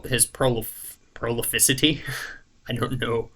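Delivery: ordinary talk, nothing marked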